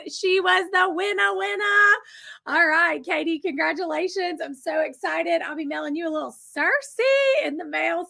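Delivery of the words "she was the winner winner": high, stretched-out gleeful announcement; celebrating a winner. Real, not acted. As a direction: draw the words out high in celebration.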